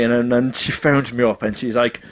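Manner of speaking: normal talking voice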